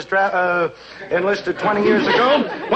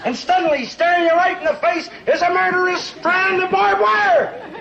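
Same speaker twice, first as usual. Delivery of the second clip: dramatic build to a shout; 7 semitones higher than usual. Acted. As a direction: voice climbing much higher into a shout on barbed wire. Dramatic war story climax.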